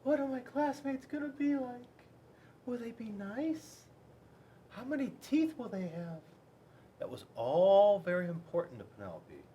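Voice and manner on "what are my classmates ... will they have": put-on high, small worried girl voice; little T-Rex's worried thoughts. A narrator's reading. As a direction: voice Penelope wondering anxiously. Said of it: "Penelope thought to herself".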